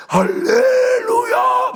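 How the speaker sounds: a very high, loud, stretched-out cry; shouted hallelujah. Retold or imitated. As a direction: let it burst out in ecstatic praise. Woman bursting out in praise.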